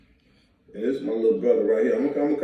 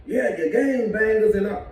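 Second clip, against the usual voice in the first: higher mock preachy critic; 7 semitones higher than usual. Guest imitating critics who lecture gangbangers, mocking higher voice. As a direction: parrot the lecturing voice. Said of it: "you sound like the police to us".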